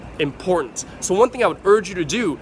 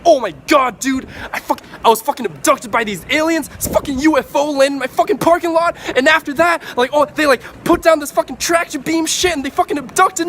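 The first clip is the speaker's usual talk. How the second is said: much higher and louder, breathless with hype; hyped excitement; 9 semitones higher, 5 dB louder than usual. Shown as a demonstration. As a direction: tell it like the craziest thing that ever happened to you. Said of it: "you would talk like that".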